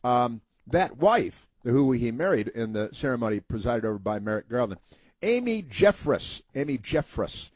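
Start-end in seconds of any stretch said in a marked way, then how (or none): none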